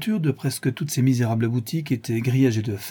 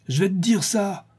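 high-pitched menacing cry; threatening exclamation; 9 semitones higher than usual. A narrator's reading. Bandit advancing on the woman in the dark, high threatening exclamation. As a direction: call it out as a threat, pitched well up. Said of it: "s'écria le bandit".

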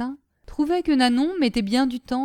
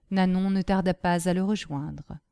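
usual speaking voice

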